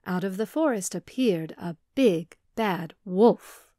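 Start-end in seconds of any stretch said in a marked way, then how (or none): none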